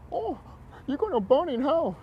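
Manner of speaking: high, mocking preachy voice; mock religious scold. Ranting vlogger mocks religious people damning him to hell, high mocking preachy voice. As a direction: sneer it like a self-righteous believer.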